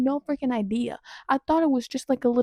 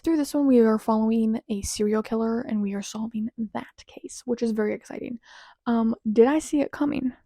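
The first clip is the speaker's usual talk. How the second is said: usual speaking voice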